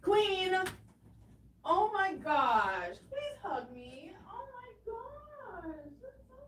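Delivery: very high, soft, near-crying gushing; high soft emotional gushing; tearful delight. Real, not acted. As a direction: melt over a sweet gift, voice catching. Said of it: "touched by the gift".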